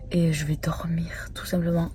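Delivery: soft whisper, almost voiceless. Real, not acted. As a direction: whisper it like saying goodnight. Quiet bedtime aside.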